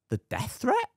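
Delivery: mock surprise, pitch leaping up; incredulous. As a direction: act amazed at what she says. Retold reply to a death-threat caller.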